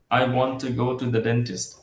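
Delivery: very slow, every word of "I want to go to the dentist" hit the same; robotic word-by-word even stress, shown as the wrong way; equal stress on every word. Shown as a demonstration. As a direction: spell out each word evenly, no weak words. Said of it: "এভাবে আসলে নেটিভরা বলে না".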